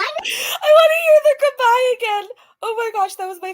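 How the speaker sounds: high and loud, bursting with delight; gushing like a delighted fan; excited shout. Real, not acted. Begging to replay the goodbye, squealing with delight.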